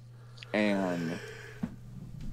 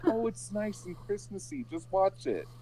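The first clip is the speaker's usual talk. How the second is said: higher, softer sweet wife voice; lightly mimicked wife, high and soft; imitating wife; 10 semitones higher, 6 dB quieter than usual. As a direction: coax him in a sugary voice. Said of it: "And she's like".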